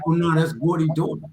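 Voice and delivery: normal talking voice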